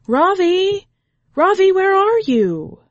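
loud high call across the woods. A narrator's reading. Family searches the woods for the boy, calling loudly. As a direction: shout his name from afar.

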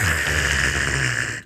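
long, low rasping crunch noise; imitated metal scrape. Storyteller imitating metal on metal, a long scraping crunch. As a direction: make a drawn-out grinding car-crash noise.

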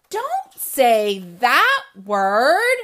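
loud, very slow refrain; comic refrain shout. A narrator's reading. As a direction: drag out the refrain loudly.

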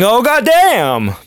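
loud, high "Oh, goddamn!" drawn out slowly; hollering at a woman on the street, a slow lustful "goddamn"; catcall. Retold or imitated. Urban guy catcalling a passer-by, loud drawn-out holler. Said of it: "seeing a ... large behind and saying".

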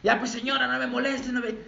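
high, laughing, snappy brush-off; mock rude reply. Preacher acts a rude reply to the line-cutter, high and laughing. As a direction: brush her off, half laughing. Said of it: "¿Cómo sería tu conversación con ella?".

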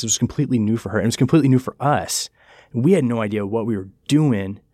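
ordinary delivery

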